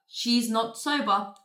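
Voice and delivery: normal talking voice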